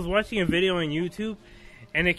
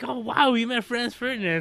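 mock amazement, higher pitched; impressed friend; 6 semitones higher than usual. Friend hearing of the meeting, mock impressed. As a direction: imitate someone wowed by the news. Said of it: "they're like".

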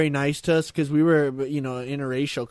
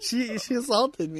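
mock crying; 6 semitones higher, 4 dB quieter than usual. Mock crying victim, laughing.

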